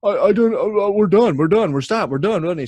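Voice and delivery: high, loud, stammering imitation of surrender; mock a flustered guy giving in; panicked backing down. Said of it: "he just apologized".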